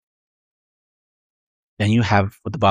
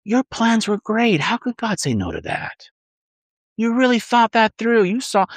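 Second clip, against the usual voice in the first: mild mock flattery of the tempter; flattering little voice; 7 semitones higher than usual. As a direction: coax like a sly inner voice, higher pitched. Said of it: "That's just a little voice".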